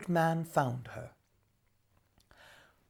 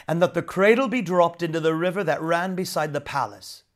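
plain reading voice

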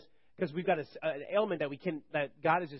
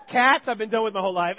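playful shout; 3 semitones higher, 7 dB louder than usual. Acted. Joke shouted about cats.

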